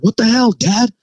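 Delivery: put-on high, exasperated teen whine; mock the kids' irritated whine; annoyed teenager. Teens annoyed at dad knocking, high exasperated whine.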